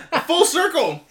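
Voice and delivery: high loud excited whoa; excited shout. Real, not acted. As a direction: shout it out in delight. Laughing after spitting water.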